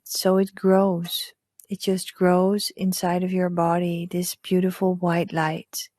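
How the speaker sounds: hushed guide leading breath and visualization; hypnotic meditation voice. Acted. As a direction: lull them gently into calm. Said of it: "that's my hypnotic voice".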